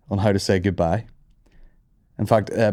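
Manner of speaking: usual speaking voice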